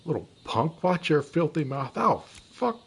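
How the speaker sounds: loud, high threatening yell; angry shout. Acted. Scammer yelling threats at the schoolboy, furious. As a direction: bark the threat back at him. Heated quarrel escalates.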